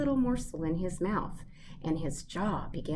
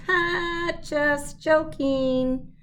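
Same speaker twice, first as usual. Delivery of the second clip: 1 laughing; 9 semitones higher, 5 dB louder, much slower than usual. A narrator's reading. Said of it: "opened it wide and laughed".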